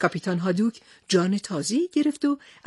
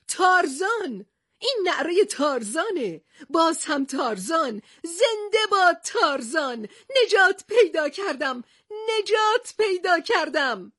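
high joyful cries, slowing at the end; overjoyed shout; 9 semitones higher than usual. Acted. Captain hearing Tarzan's call, overjoyed shouting. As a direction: shout with relief at being saved. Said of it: "و فریاد زد".

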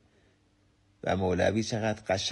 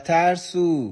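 loud pitched-up "ترسو!" in anger; angry shout; 7 semitones higher, 4 dB louder, much slower than usual. Retold or imitated. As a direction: hurl "ترسو!" at the mountain. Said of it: "پسرک خشمگین شد، فریاد زد". (فریاد خشمگین «ترسو!»)

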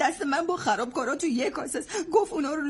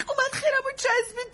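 tearful outburst; 8 semitones higher than usual. Acted. Recalling being grabbed, tearful.

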